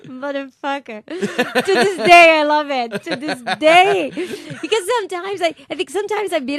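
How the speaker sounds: loud, high, laughing delight; gleeful laughter. Real, not acted. Telling the host about shooting the bully, loud gleeful laughing. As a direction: cackle with real glee at the memory.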